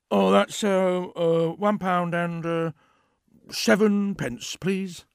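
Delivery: lightly voiced barber, stalling for a price; a hint of a shifty barber; hesitant Ray. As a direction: make up a number as you go, hesitantly.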